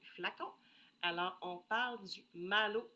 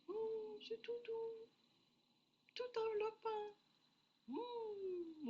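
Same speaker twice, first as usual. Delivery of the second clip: cooing; slower than usual. Real, not acted. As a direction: coo over it like something cozy. Enjoying a soft scent.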